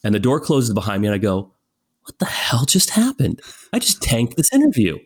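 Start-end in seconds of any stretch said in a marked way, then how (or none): 2.0-5.0 stunned whisper. Retold or imitated. Retold panic after the interview.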